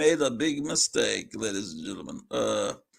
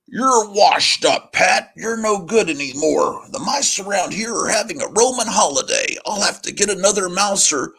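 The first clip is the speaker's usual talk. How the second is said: put-on loud, higher boss voice barking at old cat; gruff boss; 6 semitones higher, 8 dB louder than usual. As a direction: play the fed-up boss scolding his cat. Boss voiced in comic read.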